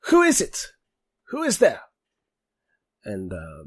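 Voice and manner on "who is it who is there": much higher, louder wary call from the dark; unseen guard calling. Acted. Hidden voice challenges the newcomers in the cave, high and wary. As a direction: call out sharply to a stranger you can't see. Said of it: "you hear someone go like".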